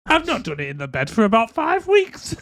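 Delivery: high-pitched comic protest; mock indignant. Guest answering a suggestion about the bed, mock indignant.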